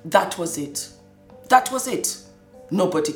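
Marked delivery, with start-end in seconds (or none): none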